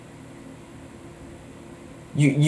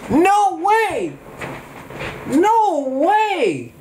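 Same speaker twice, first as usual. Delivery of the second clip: excited shout; much slower than usual. Real, not acted. Spotting something in game.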